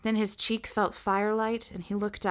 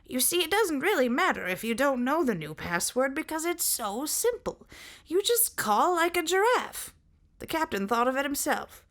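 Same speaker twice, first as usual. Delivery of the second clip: high sentry voice; 9 semitones higher than usual. Acted.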